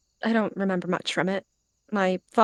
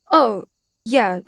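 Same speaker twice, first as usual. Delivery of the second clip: louder, higher startled reply; sudden realisation; 6 semitones higher, 7 dB louder than usual. A narrator's reading. Cat remembering her task, suddenly startled. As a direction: snap to it, raised in pitch.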